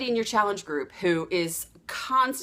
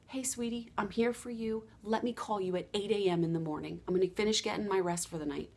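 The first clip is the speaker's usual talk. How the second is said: much softer, gentle and kind; soft reassurance; 8 dB quieter than usual. Retold or imitated. Coach answering a client in the night, soft reassurance. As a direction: murmur it like tucking someone in.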